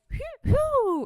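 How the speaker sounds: high, loud mock catcall hoot. Host imitating men catcalling her in the street, high hoots. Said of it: "I can't even do the, the proper sound".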